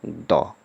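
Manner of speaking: द said short with a schwa, like "duh". Shown as a demonstration. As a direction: say "द" clipped, not "दी". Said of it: "इसका उच्चारण क्या बोलेंगे". ("द" छोटा, हल्का उच्चारण)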